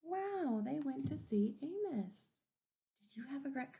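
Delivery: plain reading voice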